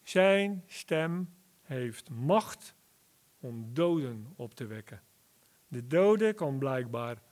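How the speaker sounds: ordinary delivery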